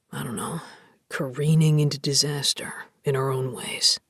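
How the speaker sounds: put-on deep, rough young man; low male voice. Voices Rye's lines.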